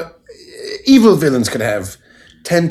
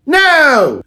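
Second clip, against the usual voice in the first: indignant no; 5 dB louder than usual. Acted. Improv guest denying he lies.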